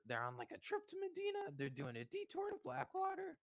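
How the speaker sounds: high-pitched sly informant passing on gossip; informant NPC voice. Retold or imitated. Player voicing the informant who tips him off, in a high sly voice. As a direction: pitch it way up like a street snitch.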